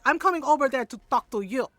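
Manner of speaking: loud, quick imitated warning; confrontational. Aunt marching over to the doctor, retold confrontational warning. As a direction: act out advancing on someone to have words.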